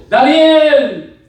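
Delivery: loud, high drawn-out cry of a name; high, ringing shout; calling out loudly. Acted. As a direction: call out to someone down in a pit. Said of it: "llamó a voces".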